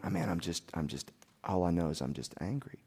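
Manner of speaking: low, soft grumble to himself; retold weary admission, well under full voice; subdued admission. Husband admitting to his wife that he is angry, subdued.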